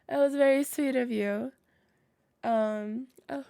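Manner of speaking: normal talking voice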